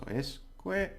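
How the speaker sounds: escuela in separate beats, "cue" lifted high; stress on second syllable. Shown as a demonstration. Teacher showing which syllable is stressed, high separated middle. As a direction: split escuela and push up the middle beat. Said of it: "the stress is on the second".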